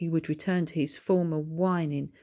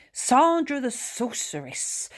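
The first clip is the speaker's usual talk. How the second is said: raised voice; 8 semitones higher, 4 dB louder, slower than usual. A narrator's reading. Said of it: "raised her voice".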